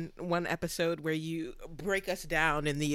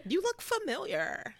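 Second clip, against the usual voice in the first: high flirty pickup line; 13 semitones higher, 5 dB quieter than usual. Retold or imitated. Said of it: "a great friend of mine always said".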